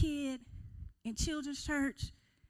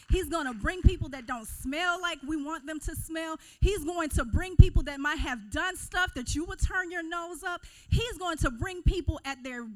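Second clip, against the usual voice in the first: building, loud preaching; rising fervor, full force; 3 semitones higher, 8 dB louder than usual. Acted.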